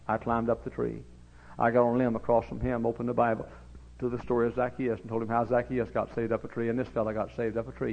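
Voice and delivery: ordinary talk, nothing marked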